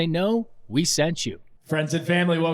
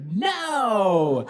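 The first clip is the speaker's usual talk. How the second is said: drawn-out, higher mock no; mock refusal; 5 semitones higher than usual. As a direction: refuse in playful dread, stretched out. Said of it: "And I was like".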